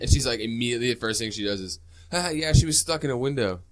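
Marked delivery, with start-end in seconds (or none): 2.1-3.6 loud, raised imitation of a tattling girl; mock gloating. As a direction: blurt it out with a put-on haha. Mimics girl mocking her friend.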